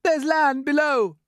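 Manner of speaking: high, loud centipede shout of discovery; excited shout. Acted. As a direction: shout it out like a lookout sighting land.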